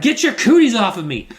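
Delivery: mock a little sister pushing him off; bratty sister. Sister shoves off a hug, high and loud. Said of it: "She's like".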